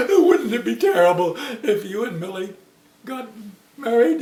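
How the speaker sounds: the father's doom-laden worry ending in a laughing near-shout; fretful silly father. Acted. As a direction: stretch the scary words, then crack up. Silly father repeats the fear.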